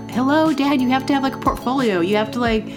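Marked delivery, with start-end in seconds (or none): none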